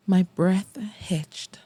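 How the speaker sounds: ordinary delivery, nothing marked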